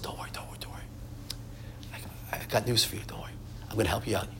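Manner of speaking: breathy, very soft reassurance; soothing whisper. Retold or imitated. Shlomo soothes the nervous man in a hushed whisper. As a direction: whisper it like a secret to calm him.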